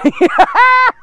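loud, high joking cackle. Pretend. Preacher joking he is stuffed with turkey, high cackle. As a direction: let out a loud, squealed laugh. Joking about being full.

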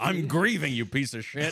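angry yell. Pretend. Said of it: "old man reply".

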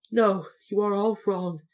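high little man's voice; mildly apologetic contradiction; meek. A narrator's reading. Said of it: "said the little man meekly".

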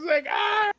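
high laughing shriek; mock scream. Friend screaming the trunk sound, laughing.

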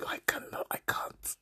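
whispered, breathless disbelief; exasperated whisper. Real, not acted. Whispering to listeners, overwhelmed by a friend's silly question. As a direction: drop to a whisper, too fed up to go on.

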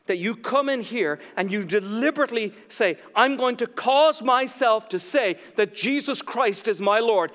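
high, loud, driving sermon delivery; impassioned preaching. Real, not acted. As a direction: preach it out to the whole room with force. Preaches at full force.

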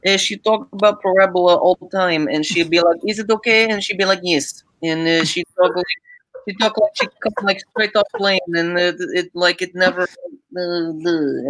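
put-on Russian accent. Imitating tenth grade algebra teacher for bandmates, Russian accent. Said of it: "I can kinda do a Russian accent like my tenth grade".

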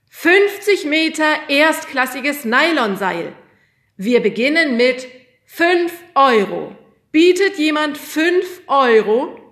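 high, loud, slow sales pitch; auctioneer voice. A narrator's reading. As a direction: call for bids to the hall. Auctioneer announces lots.

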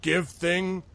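puzzled troll. Acted. Troll working out a trade, high and questioning. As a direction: piece it together in two rising chunks. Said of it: "and he thinks, he's like".

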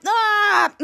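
high imitated "aah" battle cry; body-slam yell. Going in for the body slam, high mock yell.

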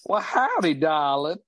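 a hint of a Southern barmaid accent; Southern drawl. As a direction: greet him like a flirty Southern barmaid. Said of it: "Thornbuckle's accent".

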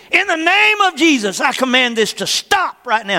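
high, loud rebuke of the storm; commanding prayer. Retold or imitated. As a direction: command the hail to stop, full force. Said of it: "she's louder than the hail".

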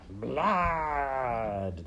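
high, long, falling rat cry; rats chorusing. A narrator's reading. Whole pack agreeing on blood, rats chorusing. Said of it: "they all agreed together".

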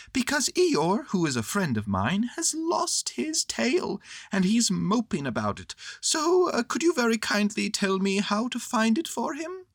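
high-pitched little bear asking for help; Pooh, troubled friend. Acted.

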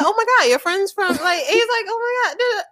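high, gushing mock amazement; hammed-up high-pitched excitement; impressed boy quote. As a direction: gush like an awestruck guy. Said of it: "And he's like".

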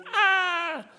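sudden high yelp of frustration; exasperated high cry. Real, not acted. Preacher crying out in frustration at parents.

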